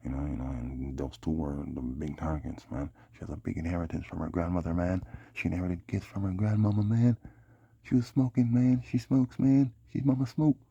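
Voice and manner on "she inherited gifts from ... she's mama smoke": excited. Real, not acted. Excited rant about her grandmother.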